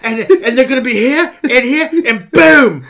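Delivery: high, loud hammed-up British pitch; mock English accent. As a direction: play a smarmy English director selling a film. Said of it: "that smooth English accent".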